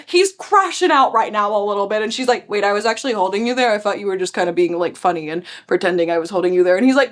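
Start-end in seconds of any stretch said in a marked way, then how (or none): 2.5-6.8 low, rushed, surprised reply; flustered girl. Retold or imitated. The girl rushing out her surprise to Kenji, low and quick. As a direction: tumble it out fast and low.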